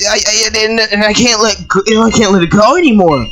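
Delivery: ordinary talk, nothing marked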